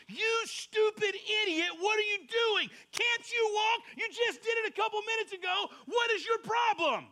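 high, loud mock yelling at a toddler; hammed-up scolding of the baby; mock angry parent. Imagined harsh parent yelling at a fallen baby, mock angry. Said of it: "you look at it and you go".

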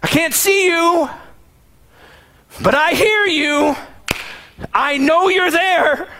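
high, slow, pleading cry; screaming out. Acted. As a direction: call out to him from far off, high and drawn out. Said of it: "screams out".

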